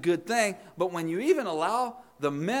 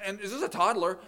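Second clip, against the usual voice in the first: usual speaking voice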